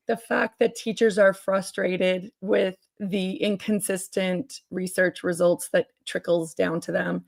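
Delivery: ordinary talk, nothing marked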